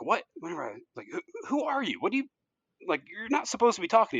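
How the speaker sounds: can't believe the vegetable speaks; stunned guard. Acted.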